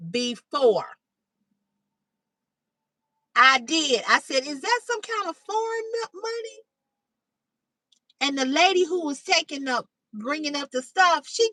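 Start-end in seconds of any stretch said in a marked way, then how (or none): 4.5-6.6 high, softening innocent query; naive retold question. Girl asks about food stamps she never saw, innocently. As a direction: ask in a higher, puzzled voice.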